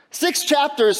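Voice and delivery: ordinary talk, nothing marked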